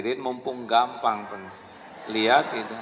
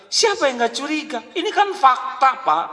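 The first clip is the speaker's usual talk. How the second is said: much higher, fast, indignant retort; suspicious wife; 13 semitones higher, 4 dB louder than usual. Pretend. Wife retorting to husband, high and indignant. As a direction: snap back like a jealous wife.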